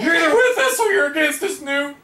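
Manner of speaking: high, loud, hammed-up declaration; over-the-top high yell; mock defiant shout. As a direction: belt out a playful accusation. Mock verdict on dead card character.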